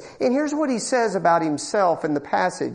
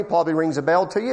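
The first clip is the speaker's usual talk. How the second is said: normal talking voice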